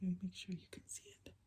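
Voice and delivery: quick hushed aside; whisper. Real, not acted. Aside to listening children.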